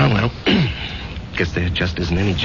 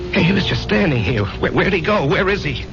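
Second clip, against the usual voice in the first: high, agitated questioning; shaken, bewildered; 7 semitones higher than usual. Acted. Man asking barman after vanished buddy, shaken and bewildered. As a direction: search frantically for someone who just vanished.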